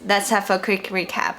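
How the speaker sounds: normal talking voice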